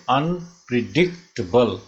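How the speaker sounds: Unpredictable stretched into separate beats; measured teaching breakdown of "unpredictable"; syllable by syllable. Shown as a demonstration. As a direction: say "unpredictable" in spaced syllables, un-pre-dic-tuh-bul. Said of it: "ये five syllable हुए".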